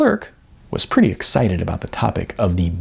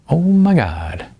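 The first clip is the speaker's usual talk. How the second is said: shocked gasp; 7 semitones higher, 3 dB louder, slower than usual. Acted. Shocked at the three hundred dollars.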